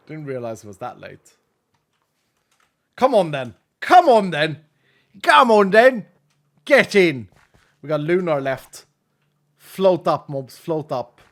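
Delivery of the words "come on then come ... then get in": rising hype shout; building urging. Real, not acted. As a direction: come on then, getting louder each time.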